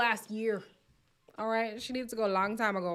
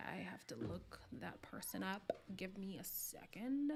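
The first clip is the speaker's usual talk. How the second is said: whisper; 3 semitones lower, 13 dB quieter than usual. Real, not acted. Reading chat, looking something up.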